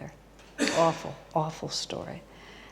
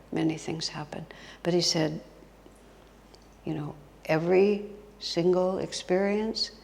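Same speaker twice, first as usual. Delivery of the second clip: normal talking voice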